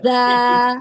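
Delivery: زا said with the teeth closed and a whistling hiss; whistled za. Shown as a demonstration. Teacher showing the student a whistled زا. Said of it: "پہلے ز آواز آئے گی".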